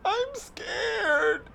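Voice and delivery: put-on very high, whimpering child; a frightened little kid; scared child.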